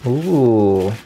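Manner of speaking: ordinary talk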